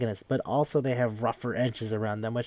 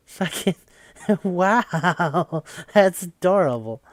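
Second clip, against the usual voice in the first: higher, laughing through the words; charmed and breaking into laughter; amused laughing; 7 semitones higher, 4 dB louder, much slower than usual. Retold or imitated. Player charmed by his mercy to a mosquito, laughing.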